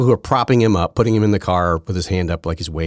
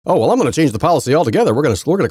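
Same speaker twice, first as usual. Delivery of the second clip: fast, louder, higher mock politician; mock official promise; 9 semitones higher, 5 dB louder, faster than usual. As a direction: rattle off an empty promise in a mocking tone. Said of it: "do you think the next person's gonna".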